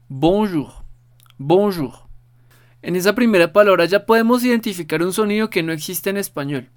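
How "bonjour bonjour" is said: "bonjour, bonjour" en francés, con la o nasal y la r final suave. Shown as a demonstration. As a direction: repetir "bonjour" dos veces, claro y como modelo. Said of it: "te lo repito una vez más". (modelo de pronunciación, repetido para el alumno)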